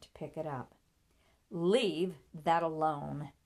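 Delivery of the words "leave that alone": slow, loud, angry command; angry outcry. A narrator's reading. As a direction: lash out, drawing the words out. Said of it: "Ada cried out, her eyes blazing".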